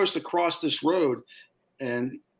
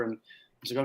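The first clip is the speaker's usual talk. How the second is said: ordinary delivery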